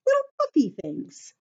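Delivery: squeaky high, quick and delighted; playful high voice. Acted. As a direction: make the puffs sound tiny and cute. Showing dandelion puffs to children.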